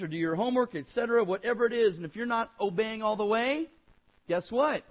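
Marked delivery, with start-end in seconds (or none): none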